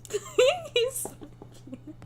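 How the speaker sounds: high, very slow swooning squeal; gushing squeal. Real, not acted. Streamer swooning over Silas, drawn-out high gush. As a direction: melt over him, stretching it out.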